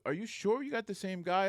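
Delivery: high-pitched disbelieving query; retold incredulous question. Troopers back from the calm guard, imitated incredulity. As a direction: imitate officers doubting the story. Troopers doubt his account.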